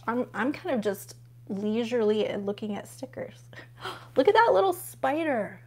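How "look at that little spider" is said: delighted gasp. Real, not acted. Gasps at a sticker.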